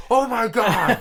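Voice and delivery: high, loud gasp of horror; horrified mom. Retold or imitated. Mom watching her son eat the poop, high and horrified. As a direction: mom aghast.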